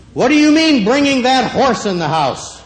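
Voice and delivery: angry mother. Retold or imitated. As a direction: scold the child like a furious mom. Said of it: "And my mother said".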